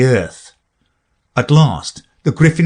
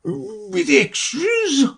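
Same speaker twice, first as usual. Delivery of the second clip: very high, very slow and hushed; anxious Mock Turtle; 4 dB quieter, much slower than usual. A narrator's reading. Mock Turtle anxiously asking Alice about extras. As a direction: ask it with worried hope, drawn out. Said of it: "a little anxiously".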